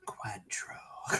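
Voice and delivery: whispered, mock-French Cointreau; mocking pronunciation. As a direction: whisper Cointreau in teasing imitation. Mocking echo of co-host's pronunciation.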